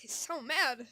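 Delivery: high, loud exclaim; loud squealed reaction. Real, not acted. Player reacting to the furious cat, loud squeal. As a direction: burst out at the angry cat.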